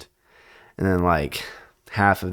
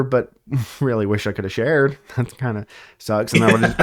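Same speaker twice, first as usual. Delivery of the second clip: ordinary talk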